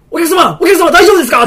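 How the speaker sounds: high, loud shout to a customer; comic re-enacted staff alarm; alarmed attendant calling. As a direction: call out urgently over someone's screaming. Attendant calls to panicking customer.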